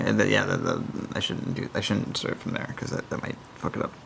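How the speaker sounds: very soft, unvoiced aside to self; real quiet self-talk; muttering. Real, not acted. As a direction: mutter it under your breath. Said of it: "muttered aside to self".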